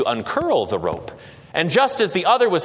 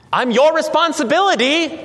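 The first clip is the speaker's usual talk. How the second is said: crying out; 10 semitones higher, 4 dB louder than usual. A narrator's reading. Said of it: "cried the other".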